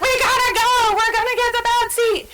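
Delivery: put-on a parent yelling at the kids because they're late for church. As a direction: hurry the kids out the door in a panic. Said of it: "you're yelling at the kids".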